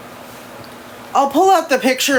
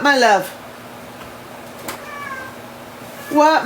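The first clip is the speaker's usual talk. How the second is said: higher-pitched pet talk; 6 semitones higher, 4 dB quieter than usual. Real, not acted. Owner answering meowing cat Daenerys, higher pet-talk voice.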